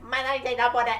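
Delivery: high, loud mimicked anime voice; high-pitched character impression. Mocking his way too high-pitched voice, high-pitched impression. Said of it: "his voice is way too high pitched".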